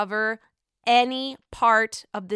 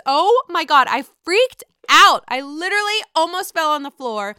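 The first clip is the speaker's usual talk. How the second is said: louder, higher burst of excitement; thrilled; 7 semitones higher, 9 dB louder than usual. Real, not acted. Fan reacting to Phaedra in the trailer, loud thrilled. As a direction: let the thrill spill over.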